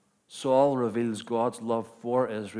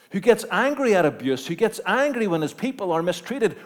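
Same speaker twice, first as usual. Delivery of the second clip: louder, higher, building sermon climax; preaching at full force; 8 semitones higher, 8 dB louder than usual. Acted. Preacher rousing the congregation about God's anger, full force. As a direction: drive it home to the congregation.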